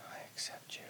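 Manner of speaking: faint whisper echoing the phrase; whispered repeat. Shown as a demonstration. Co-host softly echoing the affirmation, faint whisper. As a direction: repeat it back in a barely heard whisper. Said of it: "you can just repeat".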